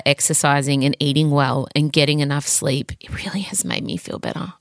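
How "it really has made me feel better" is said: half-whispered reluctant admission; whispered confession. Real, not acted. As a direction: whisper it like a guilty secret.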